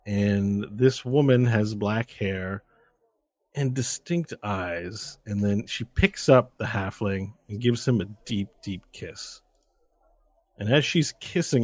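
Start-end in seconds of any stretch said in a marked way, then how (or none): none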